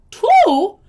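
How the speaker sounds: high, loud incredulous "Two?"; can't believe only two came; shocked disbelief. Real, not acted. Reacting to the letter's story, high, loud disbelief.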